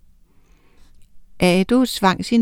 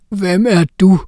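lightly voiced troll; 5 dB louder than usual. A narrator's reading. Troll questions Harald.

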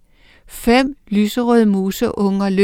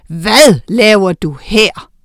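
loud, slow troll voice; a big troll brother speaking out; 6 dB louder, slower than usual. A narrator's reading. Troll and witch confront.

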